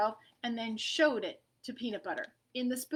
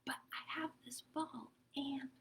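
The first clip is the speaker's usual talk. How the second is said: whispered reply; soft breathy whisper; 3 semitones higher, 12 dB quieter than usual. Acted. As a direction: whisper it back so as not to disturb. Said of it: "Peanut Butter whispered back".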